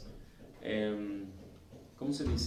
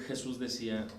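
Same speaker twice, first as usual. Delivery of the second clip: normal talking voice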